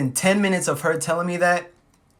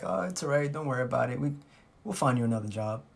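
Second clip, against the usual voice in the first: low, soft reassurance; soothing; 4 semitones lower, 7 dB quieter, faster than usual. Retold or imitated. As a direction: comfort them quietly, voice dropped. Comforting crying girlfriend in retold scene.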